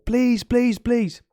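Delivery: put-on a small kid begging his mom at the door; imitating a begging child. A child pleading with mom to open the door.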